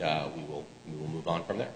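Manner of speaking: normal talking voice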